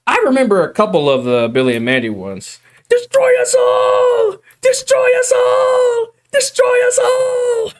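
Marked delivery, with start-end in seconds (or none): 2.9-7.7 high, slow screaming villain imitation; cartoon villain scream. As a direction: scream it like a cartoon doom prophet. Quoting cartoon villain screaming line.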